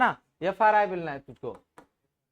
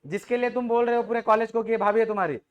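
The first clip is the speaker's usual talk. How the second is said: normal talking voice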